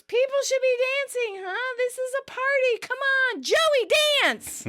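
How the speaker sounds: forced party shout. Retold or imitated. Ross shouting to the room to cover the fight, high and manic. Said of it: "Then shouts".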